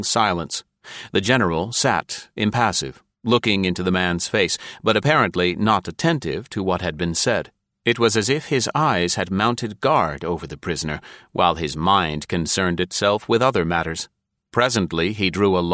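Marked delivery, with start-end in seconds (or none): none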